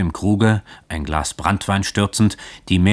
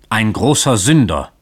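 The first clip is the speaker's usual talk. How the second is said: sharp, stern correction, louder; 4 semitones higher, 7 dB louder than usual. A narrator's reading. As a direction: cut her off sternly. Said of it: "unterbrach sie der Pfarrer streng".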